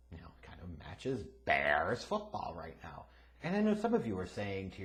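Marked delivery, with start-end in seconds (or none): 0.1-3.0 low, soft throwaway line; said quietly. Shown as a demonstration. Host hushes the Bears dig to tease fans, softly. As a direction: mutter the joke under your breath. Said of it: "Why did he say it so quiet like that?".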